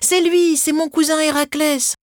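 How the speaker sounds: put-on high-pitched thrilled boy's cry; high, eager shout from a storybook child; excited cry. A narrator's reading. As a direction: call out the sighting in sheer excitement. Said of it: "Thésée se mit à crier".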